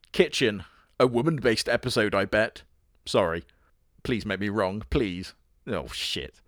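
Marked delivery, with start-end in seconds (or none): none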